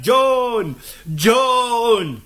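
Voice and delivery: long, high drawn-out call. Acted. Scott vainly calls his lost friend in the dark, high drawn-out call. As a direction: call for someone lost in the distance. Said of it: "în zadar striga după el".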